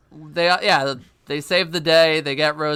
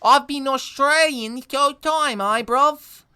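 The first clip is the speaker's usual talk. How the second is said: put-on Australian accent; 11 semitones higher, 3 dB louder than usual. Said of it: "I'm Australian now".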